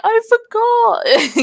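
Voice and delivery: high, slow sheepish admission. Retold or imitated. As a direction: own up with a giggle. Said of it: "I'm like".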